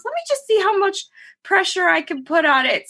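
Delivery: high, hurried mom voice; imitating determined mother. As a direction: pitch it up as Mom insisting on a try. Voices mother testing her foot.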